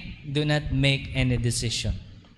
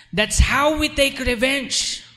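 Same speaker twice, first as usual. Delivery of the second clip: higher, louder and slow; emphatic preaching; 9 semitones higher, 4 dB louder, slower than usual. Real, not acted. Pastor hammering the point to the congregation, higher and louder. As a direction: drive the point home. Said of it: "emphatic punchline".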